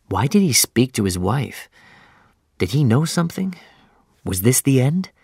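plain reading voice

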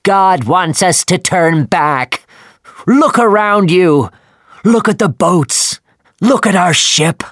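urgent mate shouting at his captain. Acted. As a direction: bellow the warning so the captain must hear. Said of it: "Starbuck shouted at Captain Ahab".

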